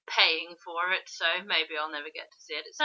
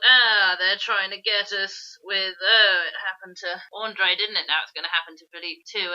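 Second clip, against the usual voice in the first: louder, mock-dramatic retelling of a hunch; excited inner voice; 8 dB louder than usual. Telling a friend about the trailer, louder and mock-dramatic. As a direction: ham up your own thrilled guess.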